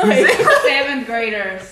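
drawn out slowly through laughter; laughing disbelief, stretched out. Real, not acted. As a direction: savour it, amused and slow. Laughing at childish drama.

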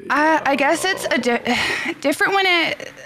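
halting, tripping over words; stuttering and restarting mid-word. Real, not acted. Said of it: "I'm stuttering".

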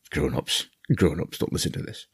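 hushed aside. Acted. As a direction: lean in and hush the grown-ups. Hushed aside to grown-ups.

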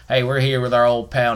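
ordinary talk, nothing marked

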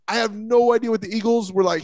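ordinary talk

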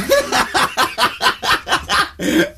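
breathless, high-pitched wheeze of laughter; high wheezing laugh. Real, not acted. Ricky cracking up at Carl's incredible things, wheezing laugh.

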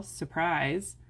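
ordinary delivery

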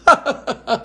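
high teasing cackle; a jolly clown's giggle. A narrator's reading. Clown laughs after joke.

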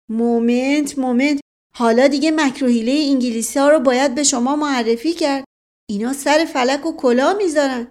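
loud, high-pitched emphatic lecture; animated interrupter. Acted. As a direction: cut in and warn them excitedly.

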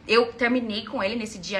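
ordinary talk

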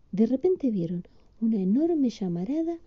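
plain reading voice

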